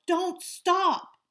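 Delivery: high, loud urgent yell; a friend yelling while dragging the boy. A narrator's reading. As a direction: shout him onward. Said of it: "would yell".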